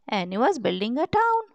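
ordinary delivery, nothing marked

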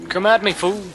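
loud, high taunt at the enemy; taunting shout. Real, not acted. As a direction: yell it to provoke the monster. Taunting a monster in game.

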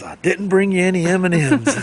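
hammed-up customer announcement; imitated customer. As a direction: call it out high, acting out the customer. Acting out a customer's teasing announcement.

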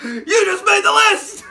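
loud, high, breaking into laughter; cracking up at his own joke threat; laughing shout. Real, not acted. Joking that Bobby made the list, laughing loudly.

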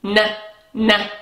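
"N" hummed as a single n sound; isolated sound. Shown as a demonstration. Teacher isolates the last sound for the children. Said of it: "last letter sound".

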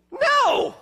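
sudden high loud cry of No!; emphatic no. Real, not acted. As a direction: throw out a sharp, high No!. Preacher exclaims denial.

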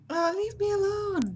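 high, loud mock protest; high-pitched mock-indignant outburst; exasperated cry. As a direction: whine it loudly at a pursuer, playfully. Protesting to pursuing game guard.

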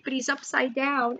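ordinary talk

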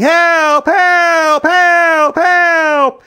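high, loud repeated screams; screaming. A narrator's reading. Policeman stuck in a traffic jam, screaming. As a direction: scream for help at full voice. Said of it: "A policeman was screaming".

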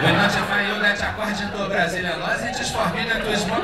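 loud, formal cabin-crew announcement; mock in-flight announcement. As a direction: read it like a clumsy pilot. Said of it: "It goes like".

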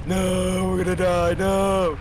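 loud, high cry of doom; panicked wail. Pretend. Player crying out as the crew faces death, panicked wail. Crew in sudden danger in game.

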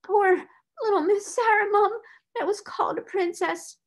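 high, wailing lament; breaking into tears. A narrator's reading. Scullery maid breaking out afresh over Sarah, tearful. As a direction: cry out in pity, pitch soaring. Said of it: "breaking out afresh".

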